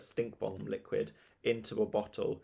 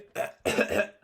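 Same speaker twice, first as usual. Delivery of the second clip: choking cough; acted coughing fit; gagging splutter. A narrator's reading. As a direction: splutter and gag on a drink. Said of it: "gagging and spluttering".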